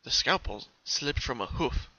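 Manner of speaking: ordinary delivery, nothing marked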